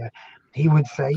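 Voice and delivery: ordinary talk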